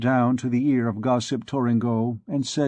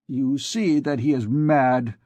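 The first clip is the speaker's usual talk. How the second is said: usual speaking voice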